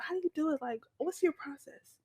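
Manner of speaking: high, soft, inquisitive voice; imitated interviewer. Rehearsing an interview bit, high soft questioner. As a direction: play a fascinated interviewer, lifted and soft. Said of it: "they always ask the British people".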